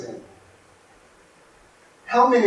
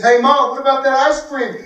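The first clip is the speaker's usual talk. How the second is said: put-on higher, louder child asking; eager kid; 6 semitones higher, 5 dB louder than usual. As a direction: remind mom, eager. Kid demanding promised ice cream.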